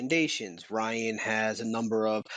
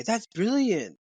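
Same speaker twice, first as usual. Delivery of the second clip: badly faked British accent, pitched up and louder; put-on UK accent, poorly done; awful British accent; 9 semitones higher, 4 dB louder, slower than usual. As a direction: do a hammy, high British "brilliant". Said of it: "That's an awful accent, I know".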